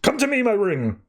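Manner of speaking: high, yearning call; lightly voiced hero summoning his ring. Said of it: "Adam's just like".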